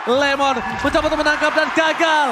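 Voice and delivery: loud, high hype play-by-play; fighting game announcer. Acted. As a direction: call the teamfight at full hype. Hype teamfight call.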